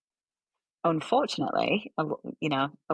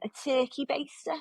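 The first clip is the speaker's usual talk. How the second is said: high-pitched mock Scouse; Scouse accent; 9 semitones higher, 3 dB quieter than usual. Cohost joking about the clinic's method, Scouse accent. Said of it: "did that in a Scouse accent".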